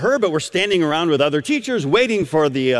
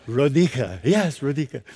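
lightly imitated Romanian accent; 3 semitones lower than usual. Grandmother repeating her name on the street, Romanian accent. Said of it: "she says in this unique accent".

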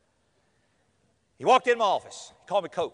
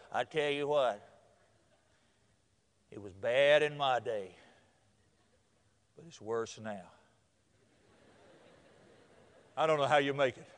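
gloomy preacher; 8 semitones lower, 5 dB quieter than usual. Retold or imitated. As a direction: drag out each doom-laden phrase. Preacher gloomily warns young people.

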